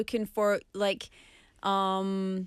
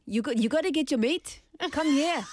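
put-on South African accent; 4 semitones higher than usual. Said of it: "South African, come on".

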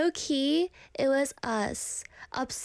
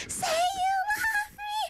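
piercing, tearful high shriek; Nikki. Acted. Toxic girlfriend demanding love, in a crying shriek. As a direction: wail it on one very high note. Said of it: "You know how she keeps saying".

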